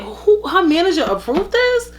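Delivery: can't believe what you're hearing; disbelief. Retold or imitated. Fan reacting to Amanda joining the show, incredulous. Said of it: "I was like".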